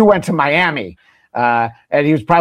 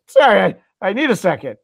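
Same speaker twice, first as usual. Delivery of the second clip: mock teary anchor; 6 semitones higher than usual. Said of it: "He's like".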